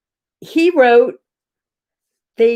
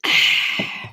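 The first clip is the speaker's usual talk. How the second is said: long, wordless breathy sigh; exasperated sigh. Real, not acted. Streamer fed up over failed vaccine booking, exasperated sigh. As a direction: let out a long sigh of defeat.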